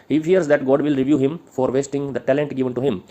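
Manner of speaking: slow, deliberate read-out; dictation. Acted. Teacher reading notes on Milton's fear, slow dictation. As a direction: dictate it slowly and firmly.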